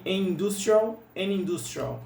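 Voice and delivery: an industrial joined as "anindustrial"; an industrial with the n carried onto industrial; an industrial quase junto; linked together. Shown as a demonstration. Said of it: "Fica assim, quase que junto".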